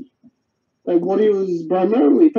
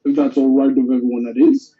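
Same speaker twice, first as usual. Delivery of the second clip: low, monotone donkey rumble; Eeyore rumbling; 6 semitones lower than usual. A narrator's reading. The donkey humbly asks everyone's leave, low rumble. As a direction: drop the pitch and rumble it out evenly. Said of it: "rumbled Eeyore".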